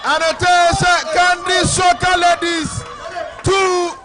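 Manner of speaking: excited shout. Real, not acted. Score after goal.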